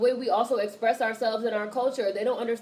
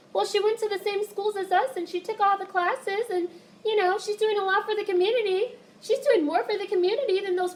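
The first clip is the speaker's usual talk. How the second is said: high, prim put-on voice; mocking academic voice; 8 semitones higher than usual. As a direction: parody a smug academic, pitched up.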